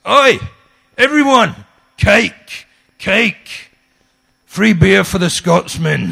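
high, loud bellow across the pub, slowing at the end; calling out. Retold or imitated. Pub landlord announcing free beer to everyone, loud and high. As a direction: shout to the whole room like a landlord. Pub owner calls out to room.

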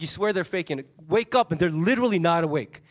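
ordinary delivery

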